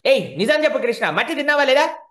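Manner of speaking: loud, high demand; mother loudly pressing her son for the truth. Acted.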